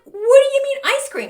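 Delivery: very high, loud mock squeal; shocked disbelief. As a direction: squeal the question back in disbelief. Retold shocked reply to mother.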